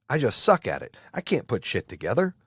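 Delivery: normal talking voice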